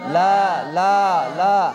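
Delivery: লা stretched long and loud; long madd লা. Shown as a demonstration. Teacher drills students on লা, stretched long. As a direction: hold the লা for a full count. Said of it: "তিন।".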